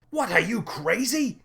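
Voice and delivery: high-pitched alarmed yell; startled, scandalized outburst; shouting. A narrator's reading. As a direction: cry out in disbelief, pitch way up. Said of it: "shouted the captain".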